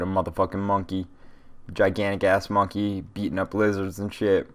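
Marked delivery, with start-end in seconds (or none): none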